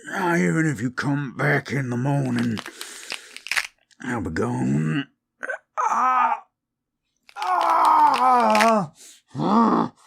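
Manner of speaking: southern werewolf warning the listener away. Acted. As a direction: menace someone who stayed too long.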